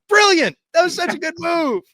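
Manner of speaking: very high, loud thrilled outburst; excited praise. Retold or imitated. Fan reacting to the surprise shooting, with high thrilled cheering. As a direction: cheer the move like a delighted fan. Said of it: "which I was like".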